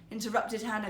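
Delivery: usual speaking voice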